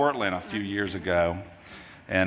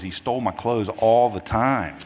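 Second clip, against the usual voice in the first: ordinary delivery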